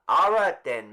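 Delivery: loud official announcement; calling out. Acted. Mister Summers to the village crowd, loud and official. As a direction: call it across the square.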